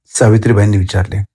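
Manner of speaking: ordinary delivery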